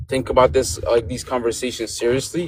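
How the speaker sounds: ordinary talk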